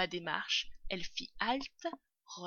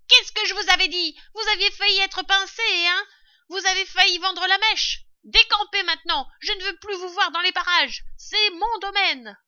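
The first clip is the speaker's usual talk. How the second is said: high, loud scolding rat; angry shouting; 12 semitones higher, 14 dB louder than usual. A narrator's reading. Rat driving intruders off his domain, shouting angrily. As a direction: scream down from a high perch. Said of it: "il cria".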